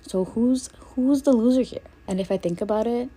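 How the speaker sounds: ordinary talk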